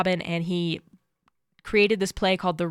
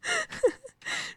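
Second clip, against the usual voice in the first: high laugh; 5 dB quieter than usual. Real, not acted.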